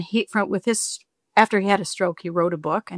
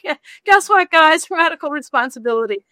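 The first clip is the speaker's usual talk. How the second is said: put-on higher, louder pep talk to kids; rally the kids with playful gusto; bright-eyed parent pitch, pitched up high; mock-peppy announcement; 9 semitones higher, 5 dB louder than usual. Cheering on the kids, retold.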